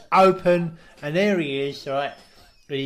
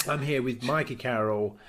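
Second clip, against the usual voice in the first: lightly voiced smug mogul; mock Simon Cowell; 3 semitones lower than usual. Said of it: "he says".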